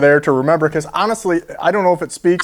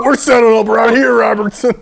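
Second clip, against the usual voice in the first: mock adamant client; 5 semitones higher than usual. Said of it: "quoting the client".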